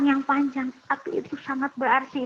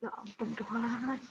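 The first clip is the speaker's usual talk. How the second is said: ordinary delivery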